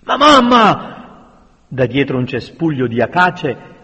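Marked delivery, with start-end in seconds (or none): 0.0-1.3 high, loud, drawn-out plea; desperate protest. A narrator's reading. Son begs mother to flee, desperate. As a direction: plead in alarm.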